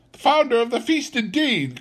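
very high, quick scornful retort; indignant cry. A narrator's reading. Wife reddens at Bob's toast to Scrooge, indignant. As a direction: throw the toast back in scorn. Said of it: "cried Missus Cratchit, reddening".

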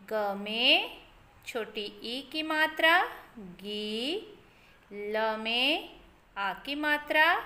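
put-on slow syllable-by-syllable reading for kids; sounding out words. Shown as a demonstration. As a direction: spell out each syllable, then blend the word. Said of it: "तो यहाँ पे हम लिखेंगे निधि गिलास".

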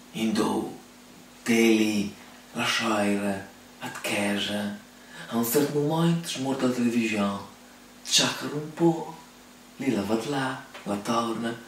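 ordinary delivery

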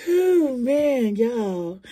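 loud, high wail after laughing; big high-pitched real exclamation after cracking up; coming out of laughter. Real, not acted. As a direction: cry it out high, worn out from laughing. Recovering from long laughing fit.

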